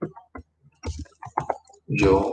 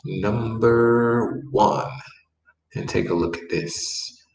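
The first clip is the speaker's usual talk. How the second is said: ordinary talk, nothing marked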